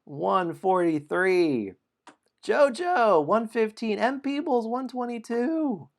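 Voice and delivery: high, loud score announcement with drawn-out numbers; announcer call-out. Real, not acted. Host announcing top scores to the players, high announcer call-out.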